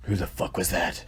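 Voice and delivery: usual speaking voice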